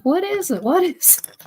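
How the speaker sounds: high urgent questions; high worried repeat; alarmed. Retold or imitated. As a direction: rush in worried. Alarmed at sister's screams.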